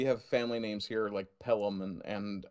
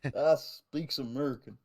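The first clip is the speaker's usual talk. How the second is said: mock yokel; 2 semitones higher than usual. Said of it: "do you speak American".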